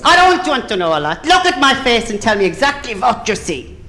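impatient mock-German interviewer; Nicky Lauda. Airline boss testing the second applicant, impatient caricature. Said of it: "And Nicky Lauda said".

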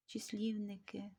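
ordinary talk

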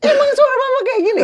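very high, squawking mom impression; comic mom protest with laughter in it; imitating angry mother. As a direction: wail in mock indignation as the mother. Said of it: "Gitu nyokap gue".

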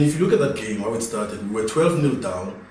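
ordinary delivery

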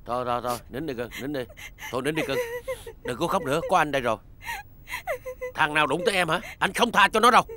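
low, soft comforting murmur; soothing. Acted. Husband soothing his crying wife, low and soft. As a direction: hush her tenderly.

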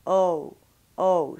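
"O" gliding into oo; gliding English vowel shown to the learner; diphthong demo. Shown as a demonstration. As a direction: let the jaw float on "O". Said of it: "You're going between O and oo".

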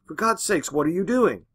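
high, loud pleading cry; horrified, crying out at her; husband crying out in horror; alarmed cry. A narrator's reading. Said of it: "he cried".

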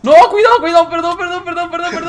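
very high shriek, fading in volume into frantic apology; panicked shrieking. Real, not acted. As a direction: panic loudly, then apologise in a high rush. Accidentally endangers teammate, apologises.

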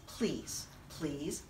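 tiny plea. Acted. Storyteller begging kids for picture books, tiny quiet plea. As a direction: shrink into a tiny begging please.

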